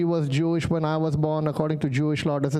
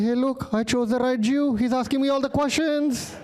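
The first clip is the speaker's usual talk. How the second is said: high, delighted divine boasting; hammed-up excited almighty; happy God; 9 semitones higher than usual. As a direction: crow with glee, pitch climbing to the end. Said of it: "God must have been happy".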